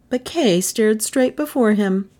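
ordinary delivery

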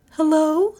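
high, questioning hello; Melissa's voice. A narrator's reading. Melissa answering a ringing phone, high voice.

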